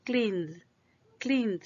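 cleaned in one syllable, E left silent, voiced D. Shown as a demonstration. Teacher shows the E is silent, voiced D ending. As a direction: close cleaned on a buzzing D. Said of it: "la E no se pronuncia".

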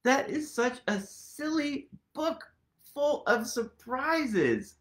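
usual speaking voice

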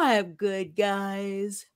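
lazy yawning drawl; fake yawn. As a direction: yawn it out lazily. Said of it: "very lazily like".

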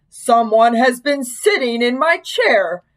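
slow, big bear voice; great big Papa Bear voice. A narrator's reading. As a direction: boom it out slowly like a big bear. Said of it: "said in his great big voice".

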